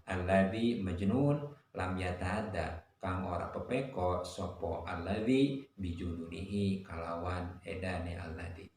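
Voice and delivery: normal talking voice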